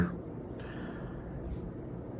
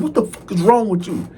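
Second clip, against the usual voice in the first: snapping; 9 semitones higher than usual. Retold or imitated. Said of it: "and I snapped".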